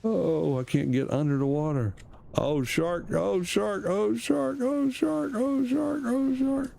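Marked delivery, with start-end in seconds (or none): 2.4-6.7 repeated alarm climbing higher and higher; rising panic. Real, not acted. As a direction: panic building with each shout.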